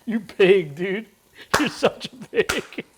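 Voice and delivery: ordinary talk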